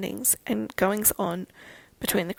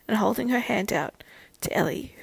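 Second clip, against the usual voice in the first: plain reading voice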